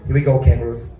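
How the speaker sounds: ordinary delivery, nothing marked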